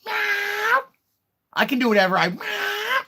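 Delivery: high, loud shrieked meow; mock meow. Dream man answering her with a loud mock cat meow. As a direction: yowl it like a cat. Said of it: "And the guy goes".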